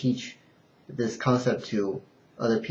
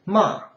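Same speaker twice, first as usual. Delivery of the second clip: MA falling sharply from high; fourth tone; 7 semitones higher, 4 dB louder than usual. Shown as a demonstration. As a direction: throw MA down from a high start. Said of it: "in the fourth intonation".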